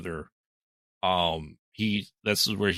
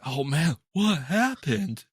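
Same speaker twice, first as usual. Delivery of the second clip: much higher, slow, put-on innocent voice; feigned innocence; 7 semitones higher, 4 dB louder, slower than usual. [name] pretends innocence after killing her, mock high. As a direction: play dumb, drawn out.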